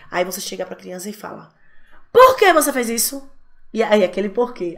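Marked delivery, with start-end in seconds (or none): 2.1-3.3 sharp, louder demanding why; stern accusing question; accusing parent. Retold or imitated. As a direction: confront the child harshly. Parent confronting child, accusing.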